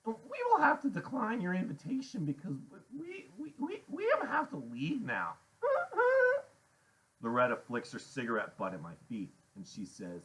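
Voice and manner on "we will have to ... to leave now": high nervous stammer with little sobbing giggles; chattery high. A narrator's reading. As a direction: decline politely while squeaking with nerves. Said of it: "his voice is chattery high".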